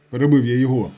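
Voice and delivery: usual speaking voice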